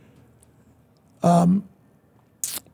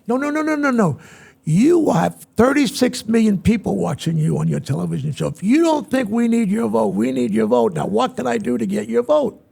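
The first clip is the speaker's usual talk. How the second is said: higher, pressing, cutting off the objection; emphatic insistence; 4 semitones higher, 3 dB louder than usual. Retold or imitated. President persuading TV star that his vote counts, insistent. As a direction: campaign hard for one single vote.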